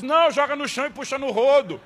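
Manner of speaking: put-on grumpy old man's comeback; rude retort. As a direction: deliver the insult deadpan-hot. Grumpy Lunga punchline.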